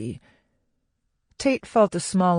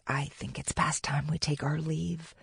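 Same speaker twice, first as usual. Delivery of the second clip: dropped to a near-whisper; hushed aside; 8 dB quieter than usual. A narrator's reading. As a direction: quietly urge a discreet exit. Urging a companion to slip away.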